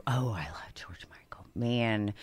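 low, soft, swooning whisper; hushed adoration. Real, not acted. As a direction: breathe it out like a fan's confession. Swooning over favorite singer.

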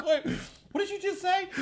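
very high-pitched mock confusion; imitated bafflement. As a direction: mimic a bewildered foreigner, voice shooting up. Baffled Europeans hearing y'all, imitated.

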